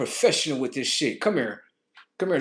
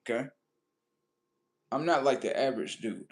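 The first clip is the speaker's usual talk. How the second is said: ordinary talk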